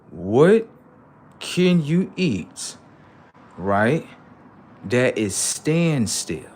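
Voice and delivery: very slow and deliberate. Shown as a demonstration. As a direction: spell it out slowly. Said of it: "I'm gonna slow it down for you".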